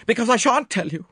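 high, loud refusal; agitated woman shutting down questions; distraught Miss Howard. A narrator's reading.

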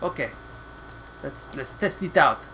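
usual speaking voice

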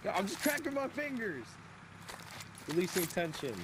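squeaky high-pitched protest; high mock innocence. Teammate feigning innocence about cracking fingers, squeaky high voice.

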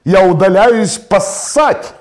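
loud, slow, drawn-out proclamation; hammed-up officer's bellow; attention-grabbing announcement. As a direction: announce grandly to the whole ballroom. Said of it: "чтобы привлечь внимание".

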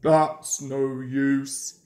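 low, slow dismissive engine; Gordon scoffing. A narrator's reading. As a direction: drag it down with disdain. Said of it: "he said".

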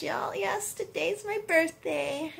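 ordinary delivery

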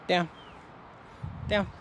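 ordinary delivery

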